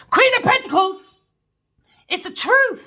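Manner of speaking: very loud, high-pitched yell; sudden shout. Real, not acted. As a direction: shout it out as a revelation. Reader yells out the card.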